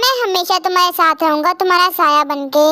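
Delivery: low, calm male voice; low reassuring male voice; soothing professor. Acted. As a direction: reassure her, deep and steady. Professor voice, lower.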